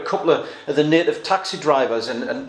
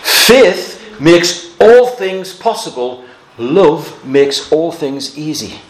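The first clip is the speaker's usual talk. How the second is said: slow emphatic quote; 8 dB louder, slower than usual. Acted. Preacher quoting Moody proudly.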